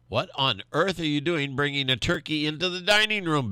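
a hint of an outraged wife bellowing. A narrator's reading. Wife scolds the farmer for bringing a turkey to dinner, mock bellow. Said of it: "bellowed Missus Francis".

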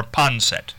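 ponset said as two syllables, PON-set; variant pronunciation. Shown as a demonstration. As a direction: cut poinsettia down to ponset, no final vowel. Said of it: "what do they say more".

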